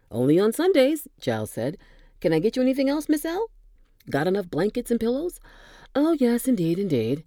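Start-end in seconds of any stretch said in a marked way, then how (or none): 0.1-1.1 higher and louder, playful quip; jovial orderly. Acted. Orderly bantering with his patient, playful and bright. Giles joking back. 2.2-5.4 higher and louder, playful quip; jovial orderly. Acted. Orderly bantering with his patient, playful and bright. Giles fussing over Janet. 5.9-7.2 impatient. Acted. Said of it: "with an air of impatience".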